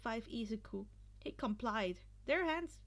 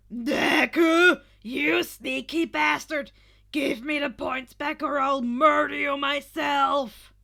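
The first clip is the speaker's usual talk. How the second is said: much louder furious shout; furious roar; 15 dB louder, slower than usual. Acted. Katsuki roaring at Deku for the points, furious. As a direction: scream it with pure rage. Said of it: "Katsuki roared".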